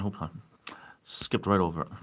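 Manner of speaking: usual speaking voice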